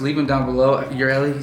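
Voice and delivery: ordinary delivery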